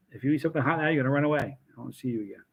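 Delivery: usual speaking voice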